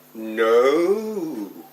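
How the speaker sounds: drawn-out cry swelling in the middle; long wailed no. Acted. Terrified child's inward protest at starting school, long wailed cry. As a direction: wail a protest that rises and falls.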